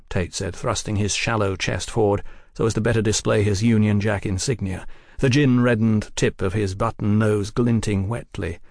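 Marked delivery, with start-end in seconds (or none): none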